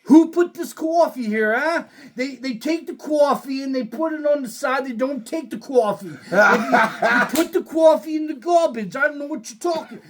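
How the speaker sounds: put-on high, fast New York accent rant; imitating an angry New Yorker; New Yorker ranting about coffee at the register. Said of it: "this New Yorker say".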